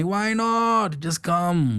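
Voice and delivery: put-on high, stretched Indian-accented exclamation. Sibling urging a broke sibling to come out, Indian accent. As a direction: coax them along in an Indian accent. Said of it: "we say it in an Indian accent".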